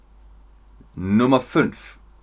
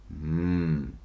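ordinary delivery

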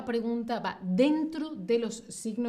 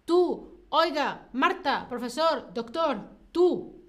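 higher, louder attention-getting calls; calling out; 5 semitones higher, 5 dB louder, slower than usual. Shown as a demonstration. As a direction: call each one out to get someone's attention. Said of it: "que llama, que apela". (llamando la atención)

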